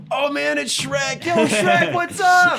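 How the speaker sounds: very high, loud imitation of a thrilled worker; excited yelling. Imitating drive-through guy spotting Shrek, hyped-up yell. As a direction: holler it like an overexcited fan, voice way up. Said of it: "the guy's just like".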